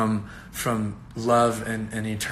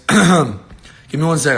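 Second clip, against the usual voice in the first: louder, slower restart; 5 semitones higher, 9 dB louder, slower than usual. Real, not acted. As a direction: give said louder and slower after clearing throat. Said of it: "clears throat".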